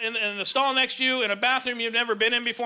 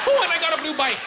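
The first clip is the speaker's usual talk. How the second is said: higher-pitched mock childlike delight; distracted excitement; 6 semitones higher, 3 dB louder than usual. Comic with attention deficit getting sidetracked, mock delighted outburst. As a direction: burst out as if suddenly sidetracked.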